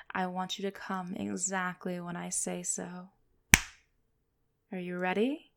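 normal talking voice

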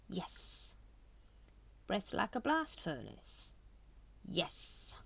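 hushed, unvoiced one-word answer; breathy hushed yes. A narrator's reading. Grand Master answering the brethren, hushed. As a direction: breathe it out quietly.

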